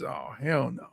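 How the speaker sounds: ordinary delivery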